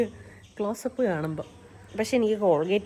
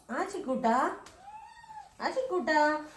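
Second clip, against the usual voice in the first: calling out; 10 semitones higher, slower than usual. Real, not acted. Calling the pet by name.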